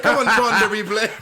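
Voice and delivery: loud, high calling of the dogs. Pretend. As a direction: call the dogs across a yard.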